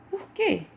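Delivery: very high "Por quê?" with "quê" stronger; stress on QUÊ. Shown as a demonstration. Teacher showing students the tonic syllable, QUÊ pronounced forcefully. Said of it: "o que ele foi pronunciado mais forte".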